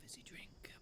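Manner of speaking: whisper. Real, not acted. Whisper under breath.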